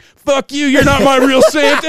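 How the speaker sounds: a furious animal rebelling; mock-indignant reindeer; angry reindeer. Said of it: "the reindeer's like".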